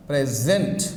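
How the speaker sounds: "present" in two distinct beats, low-pitched; "present" pronounced deliberately as a stress example; stress demo. Shown as a demonstration. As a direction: show where the stress falls in "present", voice low. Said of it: "here you get that stress".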